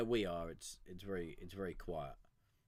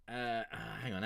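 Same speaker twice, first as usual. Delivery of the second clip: ordinary talk, nothing marked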